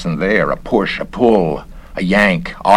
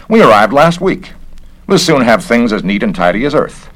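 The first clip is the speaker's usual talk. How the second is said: higher and louder, brisk and self-important; the busybody official sizing him up; officious investigator; 5 semitones higher, 4 dB louder than usual. Acted. Garrett again.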